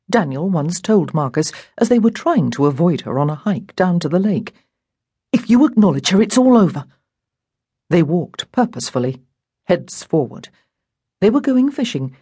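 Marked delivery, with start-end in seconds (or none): 5.3-6.8 put-on higher, urgent boy's warning; tense warning, pitched up. A narrator's reading. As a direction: warn a friend with dire urgency. Boy warns friend while avoiding sister.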